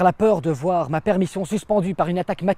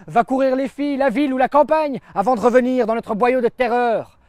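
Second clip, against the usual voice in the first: high, raised-voice send-off; brigadier calling out; 7 semitones higher, 3 dB louder than usual. Acted. Brigadier sending a soldier off on leave, calling out. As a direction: call it out to the soldier. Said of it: "m'a crié un brigadier".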